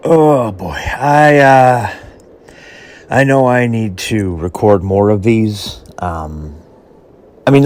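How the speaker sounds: holding back emotion, barely keeping it together; fighting back tears. Real, not acted. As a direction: voice strained, pushing words out loud. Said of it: "I have to fight those emotions back".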